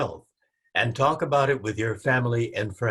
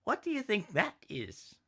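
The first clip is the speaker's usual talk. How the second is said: put-on child voice; 10 semitones higher, 4 dB quieter than usual. Child character asks.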